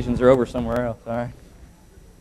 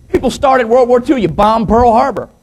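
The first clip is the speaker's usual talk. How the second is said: higher, forceful retold accusation; mock angry accusation; 9 semitones higher, 7 dB louder than usual. Pilot accusing his co-pilot, mock angry. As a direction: play a riled pilot lightly. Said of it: "was not happy about it".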